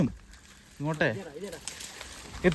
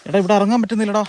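higher, louder shout for help; calling out; 6 semitones higher, 8 dB louder, faster than usual. Real, not acted. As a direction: shout down to the others. Calling out, stuck on descent.

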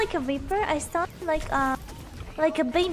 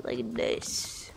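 low, soft corpse voice; hushed imitation of a corpse; 7 dB quieter than usual. Shown as a demonstration. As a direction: talk like a corpse, low and quiet. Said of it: "imagine me talk like a corpse".

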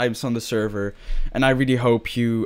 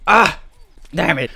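normal talking voice

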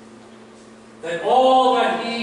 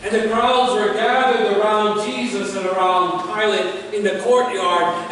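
usual speaking voice

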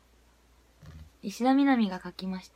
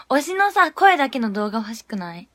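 normal talking voice

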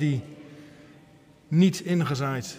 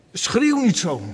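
higher, louder, scolding hush; crowd hushing; 8 semitones higher, 6 dB louder than usual. Acted. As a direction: shut the shouting beggar up, sharply. Crowd's rebuke repeated.

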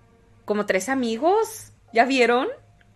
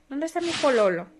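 usual speaking voice

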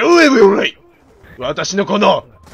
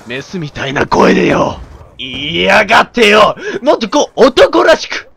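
ordinary talk, nothing marked

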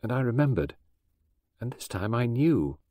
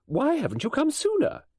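much higher, stern demanding question; severely; 14 semitones higher than usual. Acted. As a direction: demand it like a scolding friend. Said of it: "and asked severely".

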